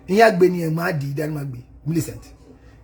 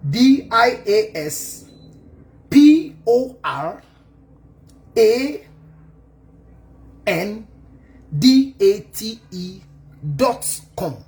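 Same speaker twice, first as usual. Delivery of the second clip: slow spelling; 3 semitones higher, much slower than usual. Shown as a demonstration. Spelling out the website name.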